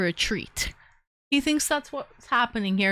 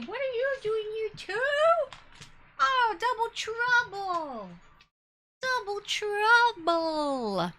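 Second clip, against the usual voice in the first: cooing at the dog; 10 semitones higher, 4 dB quieter, slower than usual. Real, not acted. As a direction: coo at the dogs like babies.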